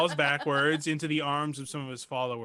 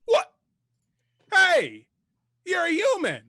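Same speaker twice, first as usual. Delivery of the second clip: high, loud startled cry of recognition; surprised npc; 10 dB louder than usual. Acted. Cult leader startled to see the player turn human, high and loud. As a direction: blurt it out, amazed to see a human. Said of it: "and he goes".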